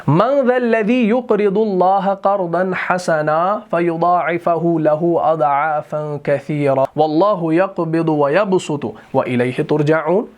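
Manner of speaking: slow chanted tajweed, vowels drawn long; Quran recitation. Shown as a demonstration. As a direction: recite the ayah in a melodic chant.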